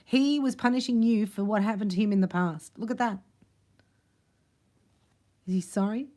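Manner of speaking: ordinary talk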